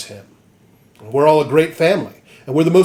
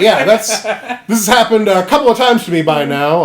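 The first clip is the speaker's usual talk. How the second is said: higher, louder, laughing through it; laughing mock Guts; 6 semitones higher, 4 dB louder than usual. Guts tired of offers, laughing. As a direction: giggle while complaining.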